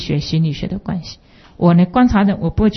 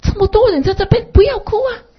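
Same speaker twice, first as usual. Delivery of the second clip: high coaxing parent's voice; parent soothing; 12 semitones higher than usual. Retold or imitated. Parents hushing their crying boy, high coaxing voice. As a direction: coax the child in a high voice. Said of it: "妈妈跟爸爸说".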